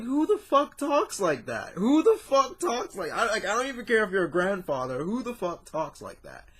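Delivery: high, loud, incredulous rant at the stilted line; who even talks like this, outraged; exasperated outburst. Retold or imitated. Reviewer mocking the grandfather's stilted line, outraged high rant. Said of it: "I'm just like".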